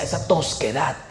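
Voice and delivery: normal talking voice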